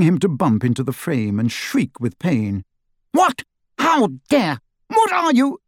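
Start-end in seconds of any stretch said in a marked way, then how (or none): 3.1-5.6 very high, indignant sputter; haughty figure squawking in protest; outraged. A narrator's reading. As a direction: splutter in affronted shock. Blindfolded portrait protests in outrage.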